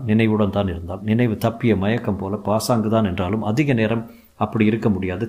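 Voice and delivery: ordinary delivery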